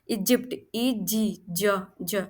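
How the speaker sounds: इजिप्ट then letters E जी J said slowly; spelling it out. Shown as a demonstration. Teacher spelling इजिप्ट for students. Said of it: "आप देख सकते हो".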